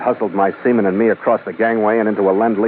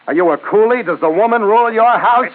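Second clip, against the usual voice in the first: taunting shout; 12 semitones higher than usual. Acted. Goading the colonel.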